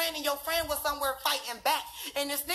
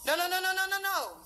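rapid string of nos, pitched higher; emphatic denial; 5 semitones higher than usual. Real, not acted. Talking to viewers, rejecting the rumor with higher insistent nos. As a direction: shut the idea down with a flurry of nos.

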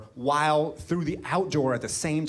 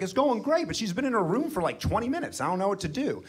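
flustered panic; 7 semitones higher than usual. Retold or imitated. Flustered virgin on phone to friend.

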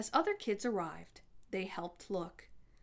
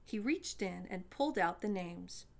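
plain reading voice

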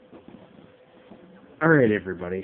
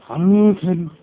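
sharp loud shout, pitched up; loud battle cry; 10 semitones higher, 11 dB louder, slower than usual. Real, not acted. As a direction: hurl it at the opponent. Calling special move.

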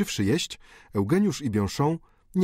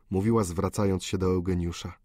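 ordinary delivery

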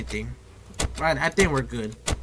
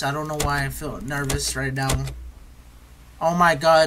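normal talking voice

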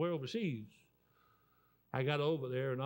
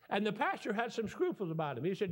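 ordinary talk

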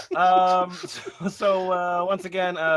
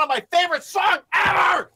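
shouting with glee; excited shout; 12 semitones higher, 5 dB louder than usual. Real, not acted. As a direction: belt it out excitedly. Excited about favorite song.

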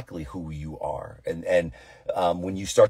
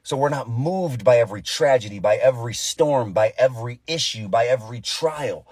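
higher, louder pulpit preaching; full-force preaching; 5 semitones higher, 3 dB louder than usual. Acted. Pastor urging believers to stand firm, fervent preaching. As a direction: preach it like a rising altar call.

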